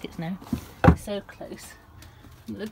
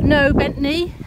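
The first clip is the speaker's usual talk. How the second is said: loud, higher sharp no; loud warning to the dog; firm telling-off; 7 semitones higher, 8 dB louder, slower than usual. Real, not acted.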